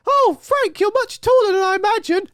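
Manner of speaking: very high, loud falsetto squeal; squeaky high fanboy voice. Retold or imitated. Starstruck fan squeaking at his hero Frank. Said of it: "I don't know where it came from".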